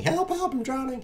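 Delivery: high, hammed-up cry for help; mock cry for help. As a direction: squeak out a pretend distress call. Pretending his piece is sinking.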